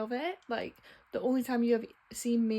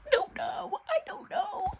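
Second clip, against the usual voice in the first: much higher, whiny squeak; helpless high wail; I don't know, squeaked high; squeaky despair. Real, not acted. Torn about the book.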